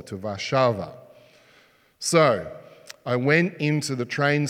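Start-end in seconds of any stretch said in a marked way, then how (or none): 0.0-0.9 Warsaw said as Czech "Varšava", stress on VAR; Czech pronunciation. Shown as a demonstration. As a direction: Warsaw the Czech way, vah-SHAH-vah. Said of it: "Uherské Hradiště to Warsaw".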